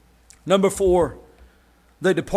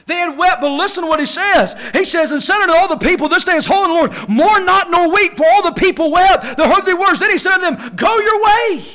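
loud, rising sermon cry over music; fiery revival preacher in full flow; full-force preaching; 10 semitones higher, 7 dB louder than usual. Acted. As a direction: preach it out to the whole church.

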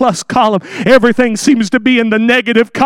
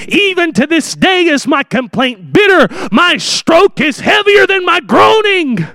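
bitter complaint; 6 semitones higher than usual. Acted. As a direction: let the bitterness ring out. Reads Job's bitter complaint.

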